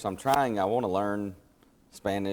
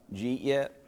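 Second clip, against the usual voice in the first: Southern slur; 4 semitones higher than usual. Shown as a demonstration. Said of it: "that Southern word".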